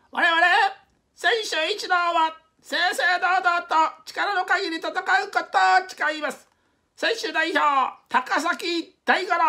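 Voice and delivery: very high, very loud, slow proclamation; loud oath. Acted. Takasaki reading the athletes' oath, a very loud slow proclamation. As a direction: bellow the oath across the field. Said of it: "大きな声で言いました".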